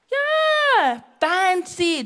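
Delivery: high, drawn-out sarcastic fancy; mock posh. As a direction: sneer at the fancy food. Mocking posh green room.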